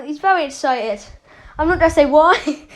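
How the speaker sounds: ordinary talk, nothing marked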